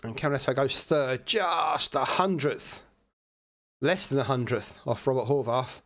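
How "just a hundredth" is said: "just" stretched long and pitched up; slow, high stretch on "just" for a tiny margin; drawn-out emphasis. Real, not acted. Commentator marks a razor-thin margin.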